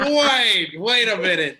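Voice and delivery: loud, high, startled halt; mock disbelief. Brother hearing about the wrestler, incredulous. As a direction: stop them short, voice jumping up. Acts reaction to undefeated wrestler news.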